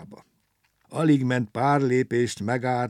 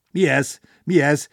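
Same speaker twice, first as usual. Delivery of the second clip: loud, higher repeated question; shouting in alarm; 5 semitones higher, 8 dB louder than usual. A narrator's reading. Engine driver sees train sliding back, startled shouting. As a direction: cry out in startled disbelief. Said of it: "kiabált a mozdonyvezető".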